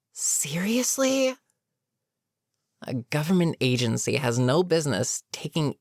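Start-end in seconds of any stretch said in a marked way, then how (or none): 0.1-1.4 high, drawn-out incredulous question; appalled disbelief. Acted. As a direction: stretch it upward in disbelief. Villain appalled at recruiting kids.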